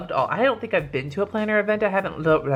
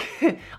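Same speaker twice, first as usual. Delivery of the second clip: giggle; 9 semitones higher than usual. Real, not acted. Laughing at own opinion.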